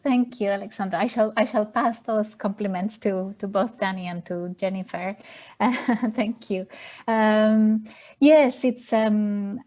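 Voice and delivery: normal talking voice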